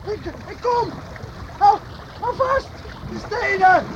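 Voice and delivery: high, urgent calls; urgent shouting. Acted. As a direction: yell while rushing to help. Rushing to help friend.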